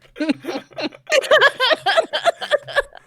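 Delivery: loud, high cackling laugh; long high peal of laughter; big laugh. Real, not acted. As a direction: burst out laughing helplessly. Reacts to creepy question.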